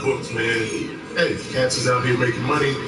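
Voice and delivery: normal talking voice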